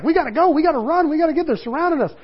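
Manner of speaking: high, fast, frantic rush of words; the terrified disciple begging to flee; panicked disciple. Retold or imitated. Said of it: "and he was like".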